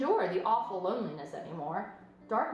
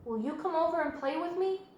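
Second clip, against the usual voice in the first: higher, eager piglet asking to play; young pig pleading; 8 semitones higher than usual. A narrator's reading. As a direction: ask like a lonely little pig. Wilbur asks the goose.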